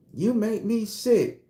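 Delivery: slow, high-pitched woman's gripe; acted quarrel.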